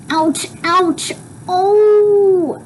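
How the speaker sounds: high, drawn-out cries of pain; pained ouches. Acted. Mother having alcohol put on her scratches, acted pain. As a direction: wince through it, pitch climbing on the last cry.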